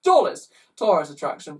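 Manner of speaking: put-on high loud child bawling; hammed-up bawling schoolchild. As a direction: howl out the disappointment. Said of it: "bawled a fourth".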